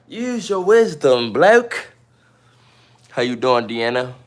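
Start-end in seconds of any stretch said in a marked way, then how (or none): 0.1-1.9 loud, high, slow mock advice; mock admonishing. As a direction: stretch it out and swing the pitch right up. Mock scolding with put-on British slang.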